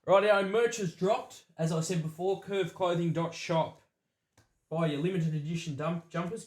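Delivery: slow, loud promo plug; ad read. Acted. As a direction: sell the merch like an announcer.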